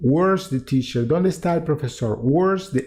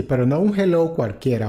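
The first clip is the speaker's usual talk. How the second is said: ordinary delivery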